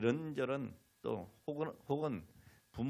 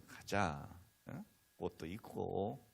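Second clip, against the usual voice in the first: gentle coaxing; 2 semitones lower, 6 dB quieter, much slower than usual. Retold or imitated. Father nudging daughter toward church, gently coaxing. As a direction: coax a reluctant child along softly. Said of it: "아, 달래는 거죠".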